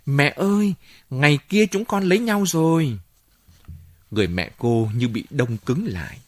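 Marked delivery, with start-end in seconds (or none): 0.1-3.0 high, raised-voice call to mother; calling out high and a little louder; excited shout. A narrator's reading. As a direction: cry out the news, pitched well up. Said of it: "cô chạy lao vào phòng và hét to".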